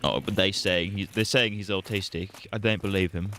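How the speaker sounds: half British, half Irish dad; a muddled British-Irish accent; mock British accent. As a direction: play the doubting British father. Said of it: "half British, that was half Irish".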